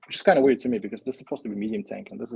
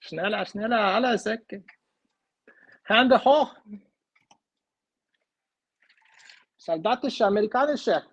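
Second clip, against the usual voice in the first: much higher, loud hammed-up German accent; butchered German voice; 9 semitones higher, 5 dB louder than usual. Voicing German soldiers in the toy battle, mock German accent. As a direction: bark fake German orders. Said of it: "I butchered German so badly".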